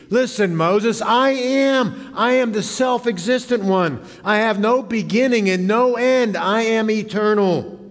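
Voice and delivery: ringing divine pronouncement, acted from the pulpit; majestic declaration. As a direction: proclaim it as God addressing a doubter. Voicing God's declaration to Moses.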